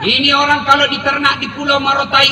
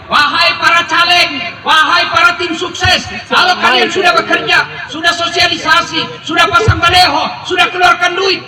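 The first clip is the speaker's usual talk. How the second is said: loud, rising sermon call; rally the candidates from the pulpit; fiery preacher's exhortation; preaching at full force; 4 semitones higher, 4 dB louder than usual. Acted.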